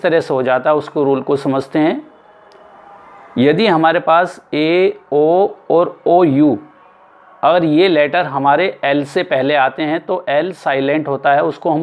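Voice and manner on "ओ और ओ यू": ordinary talk, nothing marked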